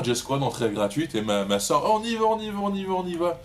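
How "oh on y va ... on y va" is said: high, eager begging; excited sister. Retold or imitated. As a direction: chirp it like an excited kid sister.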